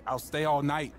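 pleading. Acted. Junior pleading to fix relationship.

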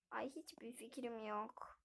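whispered cinema aside; hushed whisper in the theater. Real, not acted. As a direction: whisper so the audience won't hear.

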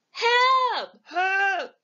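high, loud cries for help; troll crying for help. A narrator's reading. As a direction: yelp it out in a panic. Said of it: "he says".

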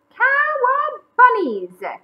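very high, loud, stretched whoop; gleeful surfer cry. Acted. Bunnies riding the waves, gleeful whoop.

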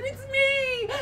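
hammed-up happy squeal; squealing joy. Rindersella answers prince.

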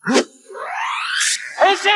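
transforming with a full-throated yell; shouted henshin. Acted. Hero shouting to transform mid-fight, full yell. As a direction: belt it out as he transforms.

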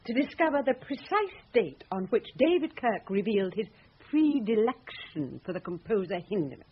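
usual speaking voice

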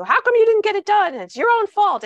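hammed-up boss yelling at an employee in front of everybody; angry boss. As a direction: blast it like a boss blaming you in public. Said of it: "he embarrasses you in front of everybody by saying".

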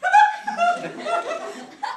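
high laugh. Acted. Mom's villain laugh.

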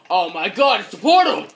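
loud high excitement; excited. Real, not acted. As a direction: shout it out thrilled. Excited discovery.

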